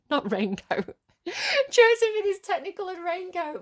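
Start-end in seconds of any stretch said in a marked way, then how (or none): 1.7-3.6 high, loud, giggly delight at a slip; amused mockery. Real, not acted. Reader laughing at her own mistaken title, amused mockery. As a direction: squeal the silly title through amusement.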